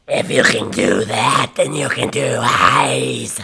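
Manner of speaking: loud, raspy false chord growl; false chord scream. Shown as a demonstration. Vocalist demonstrating false chord screams to chat, loud. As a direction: push it out through the false chords. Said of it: "That's a false chord".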